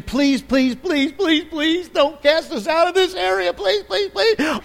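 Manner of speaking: high, desperate pleading whine, rising as it goes; begging demons. Retold or imitated. The demons beg Jesus not to cast them out, high desperate pleading. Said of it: "All they can do is beg".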